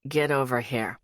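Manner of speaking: low, firm command; demanding man. A narrator's reading. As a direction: order her over, low. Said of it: "His words are demanding".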